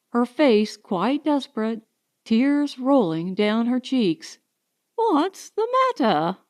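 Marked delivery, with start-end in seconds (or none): 5.0-6.4 alarmed cry. A narrator's reading. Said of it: "cried Missus Champney".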